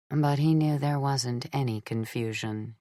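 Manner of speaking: usual speaking voice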